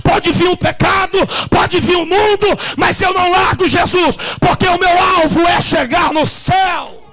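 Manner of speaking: loud, high shouted sermon with music; fiery preaching. Acted. Pastor shouting the sermon to his church, fervent. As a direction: preach it at full force to the congregation.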